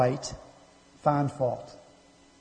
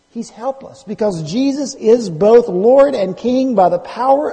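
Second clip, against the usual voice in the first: higher, slowed pulpit exhortation; emphatic preaching; 7 semitones higher, 5 dB louder, slower than usual. Real, not acted. Preacher urging the congregation to trust Jesus, emphatic preaching. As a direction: urge it in short, high declarations.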